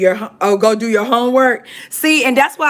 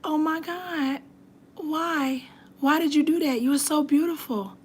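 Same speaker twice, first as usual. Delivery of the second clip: soft heartbroken disbelief; 5 semitones higher, 9 dB quieter than usual. Retold or imitated. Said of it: "And I was like".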